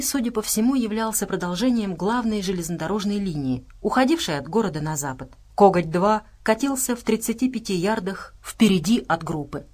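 ordinary delivery

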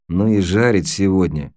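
ordinary delivery, nothing marked

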